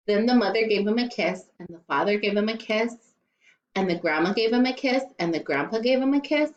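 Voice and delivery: usual speaking voice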